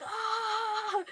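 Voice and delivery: high, loud mock yelp of pain; mock pain yell. Imitating dad yelping loudly as the tens machine hits full volume. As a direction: yelp like the shock just hit. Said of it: "My dad was like".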